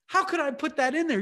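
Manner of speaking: joyful exclaim. Retold or imitated. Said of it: "And I was like".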